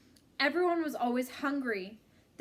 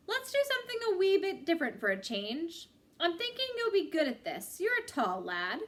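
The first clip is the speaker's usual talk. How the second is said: higher, lilting Irish aid worker; cheerful Irish coach inviting him to play; Irish accent; 5 semitones higher than usual. Acted. Irish Michael suggests volleyball to tall Salva, Irish lilt. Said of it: "Salva learned ... from Michael".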